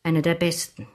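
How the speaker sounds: ordinary delivery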